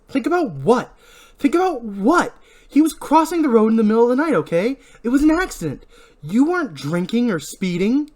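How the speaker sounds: high, loud, indignant protest; agitated rapid-fire challenge; exasperated argument. Acted. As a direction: argue back, voice climbing with disbelief. Julie argues with Ray.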